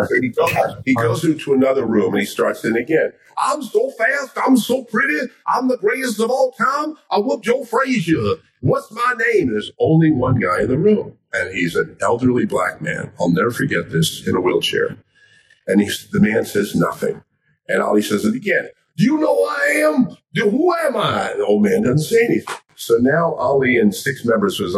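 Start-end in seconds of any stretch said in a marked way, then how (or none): 3.4-9.5 very high, crowing champion voice; high-pitched showman's brag; Muhammad Ali boasting. Acted. Ali starts boasting in another room. 19.0-21.3 very high, crowing challenge; high-pitched showman calling someone out; Muhammad Ali demanding. Acted. Ali demands recognition, acted.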